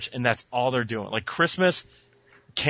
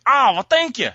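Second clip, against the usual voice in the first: imitated woman; 10 semitones higher, 6 dB louder than usual. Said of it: "she's like".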